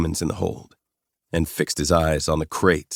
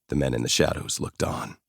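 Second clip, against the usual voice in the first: ordinary delivery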